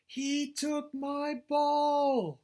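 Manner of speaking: very high, very slow loud wail; drawn-out yelled complaint; yelling. Acted. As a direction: stretch every word in a loud high cry. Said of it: "That means he's yelling with the exclamation point".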